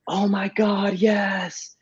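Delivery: high, thrilled squeal of yes; excited gasp. Retold or imitated. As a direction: replay her delighted, breathless yes. Girlfriend accepts hypnotist's offer.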